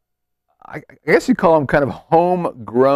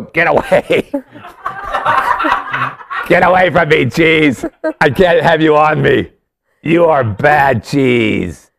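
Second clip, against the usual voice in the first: a lightly put-on French accent for talking shells; 2 semitones higher than usual. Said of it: "That was my French accent".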